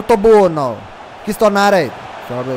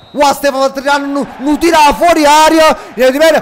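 excited; 7 semitones higher, 7 dB louder than usual. Real, not acted. Amazed at a shot.

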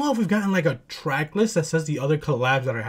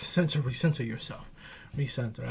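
calming self down; 6 semitones lower, 11 dB quieter than usual. Real, not acted. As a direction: talk yourself down softly.

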